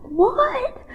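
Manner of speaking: surprised high "What?"; shocked what. Retold or imitated. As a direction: caught completely off guard. Hearing about the Ironman.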